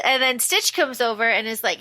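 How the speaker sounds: ordinary talk, nothing marked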